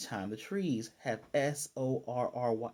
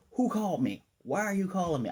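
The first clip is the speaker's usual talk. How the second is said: higher-pitched, lightly acted indignation; indignant demand; 7 semitones higher than usual. [name] answering Anya's call for her, mock indignant. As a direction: imitate someone put out at being summoned.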